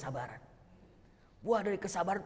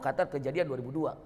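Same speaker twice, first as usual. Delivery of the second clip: ordinary delivery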